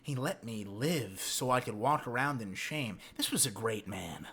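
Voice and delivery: low, soft imitation of a measured monologue; slow, spaced-out delivery. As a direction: quote it quietly, with space between phrases. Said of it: "He's talking so slow and spaced out".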